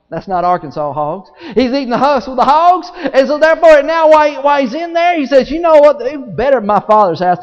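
high, loud, driving sermon; full-force preaching. Real, not acted.

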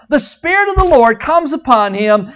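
ordinary talk

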